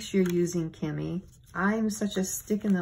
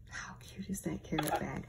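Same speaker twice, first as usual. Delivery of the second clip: near-whispered cooing over a gift; very quiet, awed aside; hushed admiration; 3 semitones lower, 11 dB quieter than usual. Real, not acted. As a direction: drop to a soft, whispery marvel.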